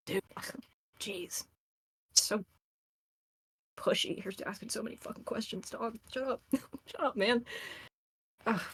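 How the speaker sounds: soft, breathy grumbling; muttered grumble. Acted. Character grumbling to the friend holding them, soft. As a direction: mutter it into her side. Grumbling from around her waist.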